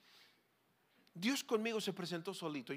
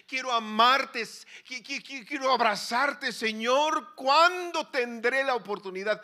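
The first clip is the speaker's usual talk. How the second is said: loud, higher cry of longing with stammer; yearning prayer; 7 semitones higher, 14 dB louder, slower than usual. Acted. Preacher voicing a longing prayer, loud and higher. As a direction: cry out with aching desire.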